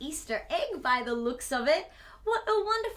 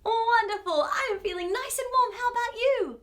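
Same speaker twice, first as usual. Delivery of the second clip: bright, quick, higher and happy; excited delight; 6 semitones higher than usual. Real, not acted. Host cheers the children after the song, excited and quick.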